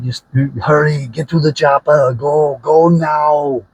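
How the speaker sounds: loud Austrian action-hero urging, stretched at the end; Arnold Schwarzenegger impression. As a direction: holler urgent orders in a mock Austrian accent. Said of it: "I'm not doing the best Arnold".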